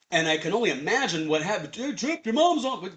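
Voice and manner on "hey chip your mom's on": calling out. Retold or imitated. Guest calling Chip to phone.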